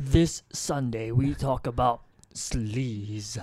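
slow, deep trailer narrator; movie trailer voice. Acted. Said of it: "that's a trailer voice".

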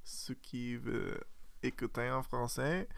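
a hint of French-accented podcast host; mock French accent. Host to listeners, in a lightly put-on French accent. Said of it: "listen to our French accents".

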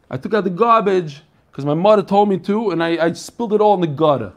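broad Brooklyn talk, dropping the R in garbage and gutter; Brooklyn accent. Shown as a demonstration. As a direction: talk like an old-time Brooklyn kid. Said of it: "I used to talk like that".

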